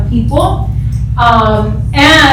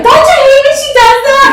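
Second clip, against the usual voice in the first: much higher, louder squeaky mock friend; higher, louder playful jab at a friend; teasing friend voice; 13 semitones higher, 4 dB louder than usual. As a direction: tease like a gossiping girlfriend. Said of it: "And then Brenda's like".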